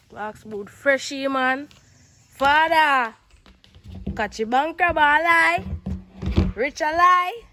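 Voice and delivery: loud, high delighted cries; excited exclaiming. Real, not acted. Vlogger shouting over her freshly cleaned boots, loud and high.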